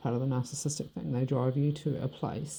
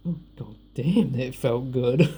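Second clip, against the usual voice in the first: louder, savoured burst of satisfaction; relishing relief; 5 dB louder, slower than usual. Retold or imitated. Woman recalling the smashed plate, relishing relief. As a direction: relive it with loud relish.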